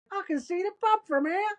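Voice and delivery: very high imitated Aussie call; mock holler from far below; retold calling up. Retold fallen man calling up.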